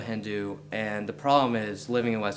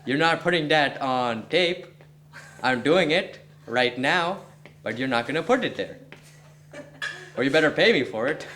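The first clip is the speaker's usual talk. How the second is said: put-on playful high Indian accent; 5 semitones higher, 3 dB louder than usual. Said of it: "I can do an Indian accent".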